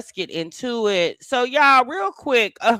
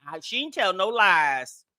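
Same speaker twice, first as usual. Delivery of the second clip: ordinary delivery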